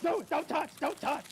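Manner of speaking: high, loud panicky movie impression; mock germaphobe panic. Germaphobe neighbor shoved by a bully, mock panicked yelp. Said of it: "he does germaphobe too".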